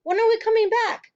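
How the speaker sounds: very high, loud desperate question; panicked plea; crying out. A narrator's reading. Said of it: "Matilda cried".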